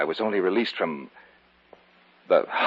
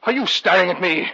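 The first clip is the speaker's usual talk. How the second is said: much higher and louder, escalating panic; frantic shout; 13 semitones higher, 8 dB louder than usual. Acted. David shrieking at the old man to stop looking at him. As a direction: scream him off with terror.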